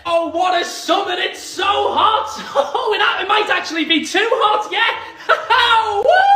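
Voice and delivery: high, giddy mock delight; manic celebration. Brit pretending rainy summer is hot, manic mock delight. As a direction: build to a high whooping finish.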